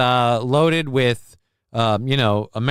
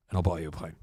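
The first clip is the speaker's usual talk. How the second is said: lower, softer, put-on Boris Johnson voice; a sly, low, quiet Boris Johnson scheming aloud; mock Boris Johnson plotting, low and hushed; Boris Johnson impression; 8 semitones lower, 10 dB quieter, much faster than usual. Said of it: "Let me do my Boris Johnson impersonation quick".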